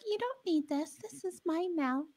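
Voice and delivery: high, soft mock spoiled cousin; hammed-up little rich-kid voice; rich entitled cousin. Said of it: "the rich cousin that gets everything from their parents is like".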